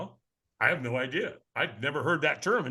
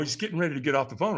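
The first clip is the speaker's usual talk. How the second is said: ordinary delivery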